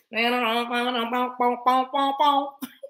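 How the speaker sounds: sung run of nonsense syllables; playful vocal riff on "bop" sounds; wordless scatting. Real, not acted. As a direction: scat like a horn riff ending in pops. Wordless musical sounds mid talk.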